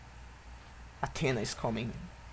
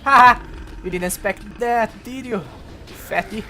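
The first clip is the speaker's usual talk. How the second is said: very loud high laugh and jeer; gloating taunt; 7 semitones higher, 11 dB louder than usual. Real, not acted. Gamer taunting an enemy he outplayed, gloating taunt. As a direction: rub it in his face.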